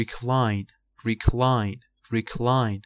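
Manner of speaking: recline punched on CLINE; light first syllable, strong second in recline; second-syllable stress. Shown as a demonstration. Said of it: "فشارش رو قسمت دومشه".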